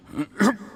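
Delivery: sudden cough as the poison hits; choking cough. Acted. Poisoned official coughing as the drink takes hold. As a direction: choke on the wine.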